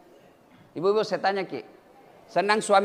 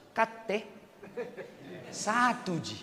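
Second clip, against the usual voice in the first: usual speaking voice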